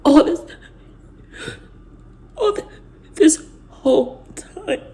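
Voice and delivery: high, broken voice through tears; words cracking as she cries; crying. Real, not acted. To her viewers, sobbing in disbelief. Breaking down over betrayal.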